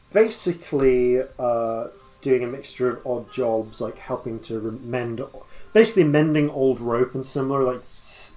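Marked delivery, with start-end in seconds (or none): none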